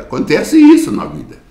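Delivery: high, ringing exclamation; a preacher's high exclamation; exclaimed. Acted. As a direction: cry the point out high to the congregation. Preacher repeats his point.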